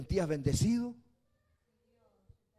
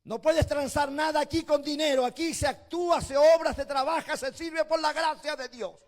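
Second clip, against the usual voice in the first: high, loud fiery sermon voice; preaching at full force; 10 semitones higher, 7 dB louder than usual. Acted. Pastor rebuking the rich church, loud fiery preaching. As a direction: thunder it out over the congregation.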